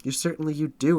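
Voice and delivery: high, loud, bitter retort; indignant retort. A narrator's reading. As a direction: throw it back at her hard. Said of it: "reported Isabella".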